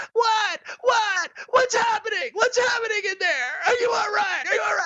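very high, frantic shriek of alarm; screaming in alarm. Retold or imitated. Someone outside the bathroom door hearing him scream, a frantic high shriek. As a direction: shriek through the door like she's terrified. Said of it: "out there screaming".

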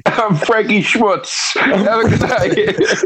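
slow, drawn-out mock club farewell; comic sign-off. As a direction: stretch the stage name out for the crowd.

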